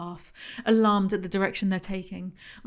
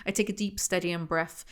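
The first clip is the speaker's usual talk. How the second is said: ordinary delivery, nothing marked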